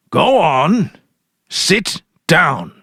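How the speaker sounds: husband ordering his wife firmly; stern command. Acted. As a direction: bark it at her to sit.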